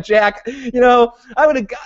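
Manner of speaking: hammed-up fed-up president, pitched high; exasperated Heller. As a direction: imitate a president at the end of his rope. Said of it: "he's just like".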